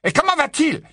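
high, urgent question; anxious father. A narrator's reading. Mr Weasley asks after George, anxious and urgent. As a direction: ask it with alarm, pitched high.

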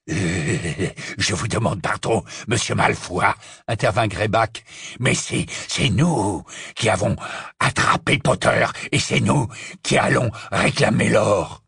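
rough, rasping creature voice; raspy werewolf. Acted. Greyback claims the reward from Malfoy, raspy. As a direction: rasp it out, halting but insistent. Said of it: "répliqua Greyback de sa voix râpeuse".

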